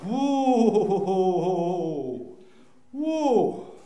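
long rising-and-falling "whoa"; imitated labour moan. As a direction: act out an early contraction. Wife's early contractions voiced.

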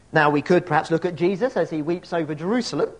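normal talking voice

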